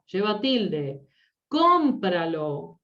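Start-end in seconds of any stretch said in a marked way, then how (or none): 1.5-2.8 "Cómpralo" slow, CÓM punched, falling away; stress on first syllable. Shown as a demonstration. Teacher showing an esdrújula word, slow with first syllable stressed. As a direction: stretch and hit CÓM in "Cómpralo". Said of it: "esdrújula".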